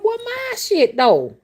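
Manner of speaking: very high, loud, incredulous demand; indignant inner thought. Retold or imitated. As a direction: ask where your money is, outraged. Inner thought, wanting payment.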